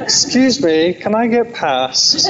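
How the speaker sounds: put-on high, polite old-lady voice, drawn out at the end; little old lady. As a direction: imitate an old lady squeezing politely past. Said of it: "there'd be a little old lady go".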